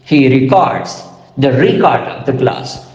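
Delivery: re-CORDS as verb, then REC-ord as noun; "records" late beat, "record" early beat; contrasting verb and noun stress. Shown as a demonstration. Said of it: "let me identify stress".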